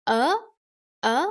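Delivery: UH swept sharply upward; short UH lifted like a question; rising tone. Shown as a demonstration. Teacher shows the hat letter, UH with a rising tone. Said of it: "This one is also with the rising tone".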